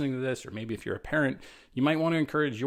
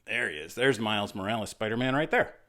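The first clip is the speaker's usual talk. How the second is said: ordinary delivery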